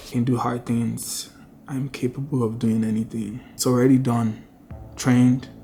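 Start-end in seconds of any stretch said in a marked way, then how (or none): 0.1-3.4 whispered affirmations to herself; whispering. Real, not acted. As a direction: breathe the words out softly.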